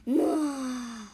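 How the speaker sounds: long weary sigh; sleepy pig's sigh; heavy sigh. A narrator's reading. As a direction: let out a glum sigh. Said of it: "The pig sighed".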